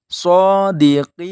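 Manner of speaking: sodikin, slow, the n held and stopped; sodikin, closing on n. Shown as a demonstration. Teacher modelling sodikin at a pause, slowly.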